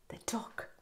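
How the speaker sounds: hushed, secret whisper. Acted. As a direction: breathe it like a surprise. Whispered reveal to children.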